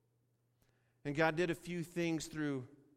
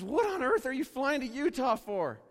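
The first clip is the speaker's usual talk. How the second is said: much higher and louder, baffled demand; incredulous question; 12 semitones higher, 12 dB louder than usual. Retold or imitated. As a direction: blurt it out in total disbelief. Seatmate demands why he flies Utah.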